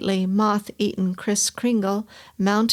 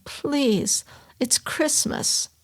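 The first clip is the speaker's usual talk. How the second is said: gently; 5 semitones higher, much slower than usual. Acted. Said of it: "he said very gently".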